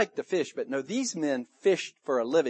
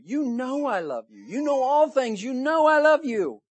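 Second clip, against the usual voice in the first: high voice, building louder; pleading insistence; 9 semitones higher, 5 dB louder than usual. Acted. Peter answers Jesus the third time, pleading insistence. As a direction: plead harder with each repetition.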